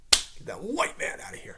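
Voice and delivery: whispered mocking jeer. Mocking the show's writers, whispered jeer. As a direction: sneer it like a mob.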